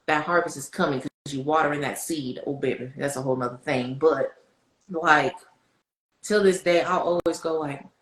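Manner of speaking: normal talking voice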